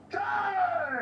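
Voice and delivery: long, high-pitched exclamation; anguished outcry. Acted. Man cries out amid a killing accusation, dismayed. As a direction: cry out in dismay, holding the word.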